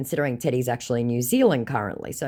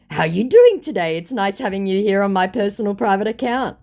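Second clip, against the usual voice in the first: scammer voice; 7 semitones higher, 6 dB louder than usual. Retold or imitated. Voicing scammer's messages.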